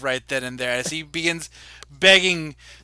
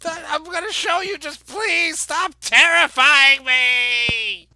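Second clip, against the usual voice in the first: high, trembling plea to stop; frightened pleading; 9 semitones higher, 7 dB louder than usual. Acted. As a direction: beg them to stop, frightened. Captive still begging.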